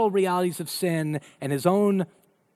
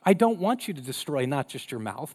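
usual speaking voice

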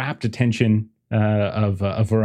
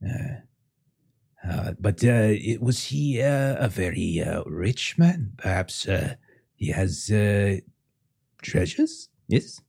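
unsettled French parent. Acted. French parent asking about a rich man's treasures, tentative.

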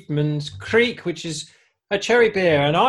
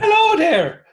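much higher squeaky mock hello; mock greeting; 6 dB louder than usual. Mocking the buyers with a high, silly hello. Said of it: "they said".

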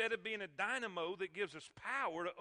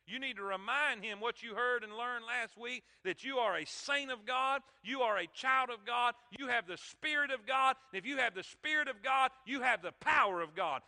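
louder and higher, building to a shout; preacher rousing the congregation, building louder; preaching at full force; 3 semitones higher, 8 dB louder than usual. Real, not acted. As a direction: drive each line harder toward the climax.

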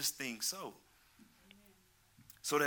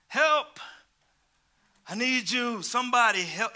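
high, loud, frantic yell of a tormented man; desperate cry for help; 9 semitones higher, 9 dB louder than usual. Retold or imitated. Preacher acting the possessed man's cry, screaming. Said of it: "This man was in the tombs crying out".

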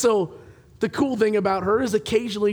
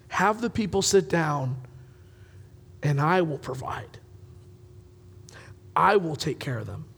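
reassuring; 4 semitones lower, 5 dB quieter than usual. Acted. Jesus voiced reassuring disciples.

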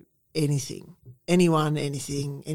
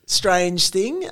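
ordinary delivery

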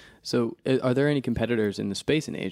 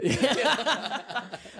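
bright burst of laughter; high laugh; 11 semitones higher than usual. Real, not acted. Laughs at the joke.

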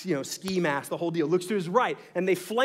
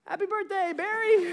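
high, small, sheepish "Happy birthday"; sing-song it like a guilty surprise; sheepish birthday greeting; 13 semitones higher than usual. Retold or imitated. Kidnapper friends greeting Barry after the prank, high and sheepish. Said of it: "And they're all like".

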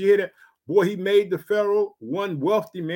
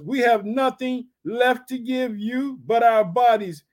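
raised, measured Bible reading voice; scripture reading; 4 semitones higher, 4 dB louder, slower than usual. Acted. Preacher reading Genesis to his congregation, raised and measured. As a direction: read the verses out to the congregation.

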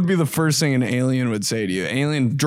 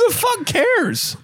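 exasperated; slower than usual. Real, not acted. High, slow exclamation.

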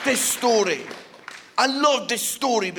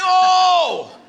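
loud, high drawn-out "oh"; mock dismayed cry; 7 semitones higher, 8 dB louder than usual. Comedian reliving his horrendous first show, loud high dismayed cry. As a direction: wail it in comic dismay.

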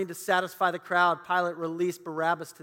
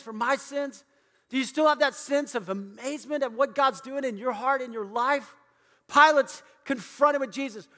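raised, impassioned preaching cadence; preaching at full force; 5 semitones higher, 3 dB louder than usual. Acted. Pastor urging the congregation to feel awe, impassioned preaching. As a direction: drive it home to the congregation.

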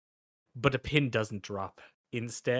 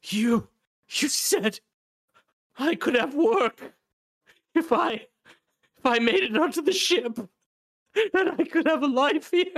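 very high, strained pleading; wretched, aggrieved little man; desperate crawling man; 6 dB louder than usual. Acted. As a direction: plead in halting bursts, voice pushed way up.

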